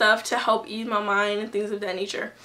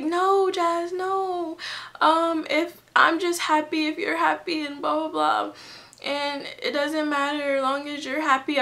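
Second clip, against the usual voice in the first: much higher, gentle mom voice; reassuring mom; 6 semitones higher than usual. Retold or imitated. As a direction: soothe like a loving mother, pitched up.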